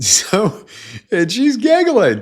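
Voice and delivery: high, loud, giggling through the words; laughing while talking. Real, not acted. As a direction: let the laugh spill into the words.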